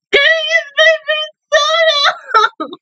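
high, slow, tearful cry of a plea; real tears breaking through, high and drawn out. Real, not acted. Watching the show, pleading for the baby in tears. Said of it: "I'm about to cry again".

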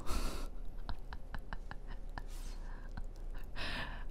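breathy, voiceless giggling; whispered laughter. Real, not acted. Player amused at shouting down Estormo, whispered laughter. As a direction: laugh under your breath, keeping to a whisper.

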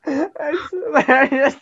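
talking through real laughter, high and loud; words cracking up into hysterical giggles; helpless laughter. Real, not acted. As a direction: barely get it out for laughing. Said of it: "I'm laughing so hard".

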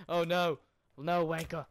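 loud surprised yell; sudden frustrated shout; real yell. Real, not acted. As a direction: shout in dismay at the screen. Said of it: "sudden death in game".

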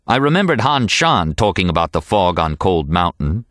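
plain reading voice

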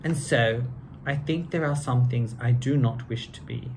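lively storybook read-aloud; reading to children. A narrator's reading. Two hosts reading Seuss to kids, lively storybook voice. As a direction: read it like a bedtime picture book.

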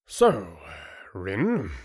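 drawn-in lordly sneer. A narrator's reading. Chieftain turning on Rin before the council, sneering. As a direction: needle him as a scornful chieftain. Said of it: "the chieftain said with a sneer".

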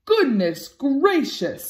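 slow, astonished fatherly voice; father dog amazed by the insult; indignant dad. A narrator's reading. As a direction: scoff at the very idea. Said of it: "said Axel".

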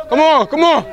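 shouted high encouragement; cheering. Real, not acted. Cheering from dugout.

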